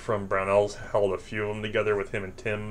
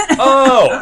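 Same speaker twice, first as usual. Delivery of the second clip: mock stunned oh; 14 dB louder than usual. Stunned reply in retold story.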